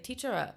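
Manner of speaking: teacher with an extra uh at the end; Chinese-style teacher uh. Retold or imitated. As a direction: add a little "uh" after "teacher".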